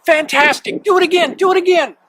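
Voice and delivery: high, loud cheering; excited shout. A narrator's reading. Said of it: "but Elizabeth shouted".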